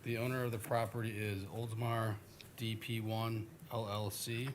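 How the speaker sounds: normal talking voice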